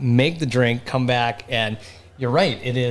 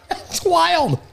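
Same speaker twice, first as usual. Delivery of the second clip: laughing; 13 semitones higher than usual. Real, not acted. Laugh at cold sensation.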